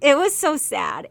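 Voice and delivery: high, loud wail of sympathy; mock-anguished outburst over a sad scene; loud lament. Real, not acted. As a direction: cry out how sad it was. Exclaims sadness about scene.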